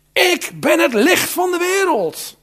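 high, loud, slow proclamation; Jesus calling out. Acted. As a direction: cry it out over the festival crowd. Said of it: "roept Jezus".

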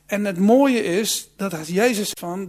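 ordinary delivery

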